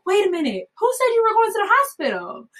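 high-pitched retold disbelief; taken aback. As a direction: imitate a midwife challenging a worry. Midwife challenges her crying fear.

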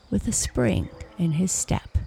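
ordinary delivery, nothing marked

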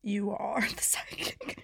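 slow, hushed teasing echo; mock-awed whisper. Co-host echoing the story's psychic line, in a slow whisper. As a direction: whisper it slowly with a little laugh.

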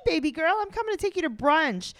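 hammed-up chirpy dad invitation; dad on phone. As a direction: imitate a dad's gushing hello. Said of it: "her dad calls and he's like".

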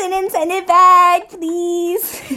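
high, sweet begging voice; cute pleading. Pretend. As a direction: beg sweetly like a child. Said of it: "you're cute".

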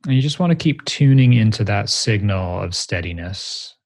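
ordinary talk

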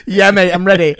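loud, keen reply; eager. Retold or imitated. Customer telling his barber he's ready, loud mock eagerness. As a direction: answer as if hopping up from the seat. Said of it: "I hop up like".

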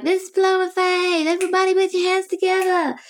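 mimicking [name]'s crowd shout; imitating [name]. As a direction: hype the crowd like a soul diva. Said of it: "Shaka's like".

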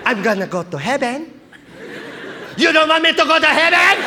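grandmother's rising, indignant questions; Lola Tinay. Retold or imitated. Grandmother challenging her grandson about heaven, building to high loud protest. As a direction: build from measured to loud, high-pitched outrage. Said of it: "she'd be like".

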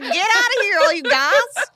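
high-pitched hammed-up holler; mock shooing. Locals shoo goths out of the woods, mock high holler. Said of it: "they're trying to shoo goths".

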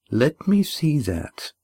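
slow, firm demand. A narrator's reading. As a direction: insist on seeing it. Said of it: "demanded Oron".

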